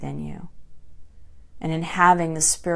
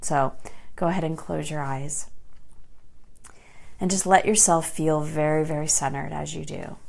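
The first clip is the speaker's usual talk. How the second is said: ordinary delivery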